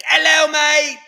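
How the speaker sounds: high, loud British caricature slipping towards Australian; bad British accent. Visitor to England meeting Mort, bad British accent. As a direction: screech it like a hammed-up Brit. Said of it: "with my bad accent work".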